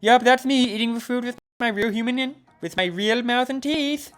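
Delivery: eager Polly. Acted. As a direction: insist loudly in Polly's high voice.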